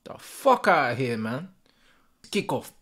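ordinary talk, nothing marked

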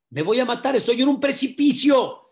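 high, loud acted panic; alarmed protest. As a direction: protest for your life over a cliff. Climber protests he will die. (protesta alarmada)

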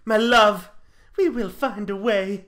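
high, swelling lover's vow; soap-opera ardour; swooning devotion. Acted. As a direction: declare it grandly to a sweetheart. Fern's melodramatic vow to lover.

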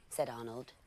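ordinary delivery, nothing marked

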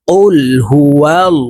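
drawn-out tajweed chant; slow recitation. Shown as a demonstration. Teacher models the verse for students, slow chant. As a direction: recite slowly with heavy lam. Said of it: "এই লামকে ল অর্থাৎ মোটা করে পড়তে হবে".

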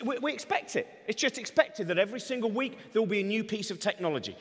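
ordinary delivery

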